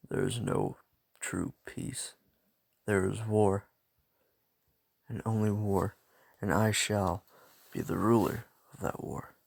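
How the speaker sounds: a low, menacing villain inner voice; dark inner voice. Acted. Izuku secretly plans to rule the war, low menacing inner voice.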